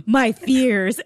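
loud, hammed-up cry of fright; mock dramatic fear. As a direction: play up the fright for laughs. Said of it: "I was like".

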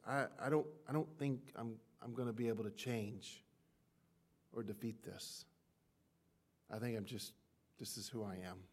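half-swallowed words of quiet defeat; a touch of hushed resignation; secret doubt. Retold or imitated. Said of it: "we secretly say it like this".

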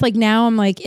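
ordinary talk

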